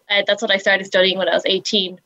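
ordinary delivery

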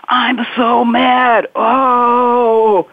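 mock frustrated groan; demonstrated anger release. Shown as a demonstration. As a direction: let the mad feeling out slowly. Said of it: "You go".

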